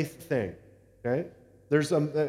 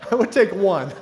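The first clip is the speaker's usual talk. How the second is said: laughing repeat; 4 semitones higher, 7 dB louder than usual. Retold or imitated. Pastor laughing as he repeats his daughter's line, higher and louder. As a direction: repeat her line high and loud through laughter. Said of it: "she's like".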